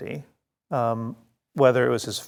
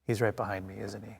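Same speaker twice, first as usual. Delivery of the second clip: sheepish hush; 5 semitones lower, 14 dB quieter, faster than usual. Retold or imitated. Character caught gossiping, hushed and sheepish. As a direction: drop to a hushed, sheepish aside. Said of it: "the character goes".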